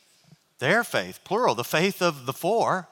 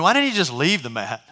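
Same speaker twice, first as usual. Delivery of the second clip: ordinary talk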